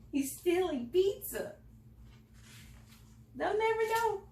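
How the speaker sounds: high, put-on sneaky voice; sneaky thieves. Narrator voicing pizza thieves, high and sneaky. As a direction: play a gleeful little thief.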